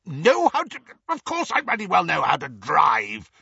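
indignant splutter, high then pushed out; spluttering outrage. A narrator's reading. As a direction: sputter it in offended disbelief. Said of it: "spluttered Uncle Vernon".

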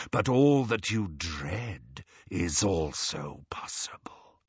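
slow, whispery serpent voice; sinister hiss. A narrator's reading. Horcrux working on Ron's dreams and fears, sinister hiss. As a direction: tempt and threaten in a drawn-out hush. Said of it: "a voice hissed from out of the Horcrux".

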